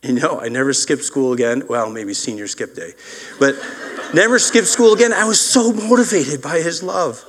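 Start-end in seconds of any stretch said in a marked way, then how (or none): 3.4-7.2 laughing emphasis. Real, not acted. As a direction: burst out laughing as you insist.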